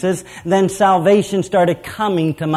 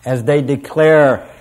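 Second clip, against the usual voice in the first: usual speaking voice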